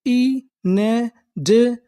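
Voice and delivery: Inadequate drawn out slowly, syllable by syllable; teacher sounding it out slowly. Shown as a demonstration. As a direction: break it into slow parts. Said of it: "तो हमारी पूरी meaning क्या बनी?".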